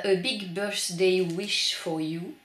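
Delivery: put-on slow, deliberate English with a French accent. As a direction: sound out each English word in a hammed-up French accent. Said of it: "cet accent anglais absolument merveilleux". (anglais lent et appuyé avec l'accent français)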